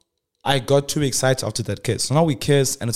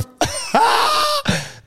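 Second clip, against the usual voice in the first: very high, squealing burst of laughter; high squeaky laughter; 4 dB louder than usual. Real, not acted. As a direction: crack up at your own mistake. Laugh after burp confession.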